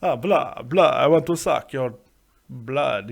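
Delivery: put-on hammy Transylvanian vampire accent; Dracula accent. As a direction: do a mock Transylvanian count. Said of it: "with a Transylvanian Dracula accent".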